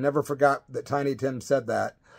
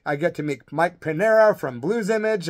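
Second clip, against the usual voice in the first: ordinary talk, nothing marked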